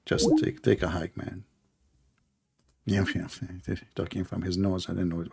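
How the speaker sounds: hushed, almost inaudible; barely audible. Real, not acted. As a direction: mutter it away from the mic. Quiet aside during call.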